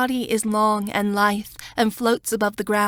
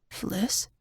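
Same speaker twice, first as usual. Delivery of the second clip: soft, hushed call of her name; hushed warning; 8 dB quieter than usual. A narrator's reading. Smock warns of danger.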